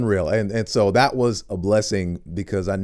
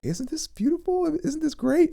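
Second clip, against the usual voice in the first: high mock celebrity voice.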